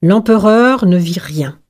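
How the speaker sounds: ordinary delivery, nothing marked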